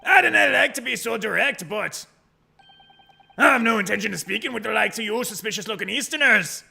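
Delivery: loud, haughty fake Scottish; Barry Caden. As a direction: dismiss them in a high, blustering Scottish accent. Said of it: "I'm the governor, Barry Caden".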